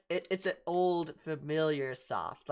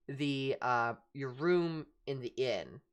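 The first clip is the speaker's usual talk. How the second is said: ordinary talk, nothing marked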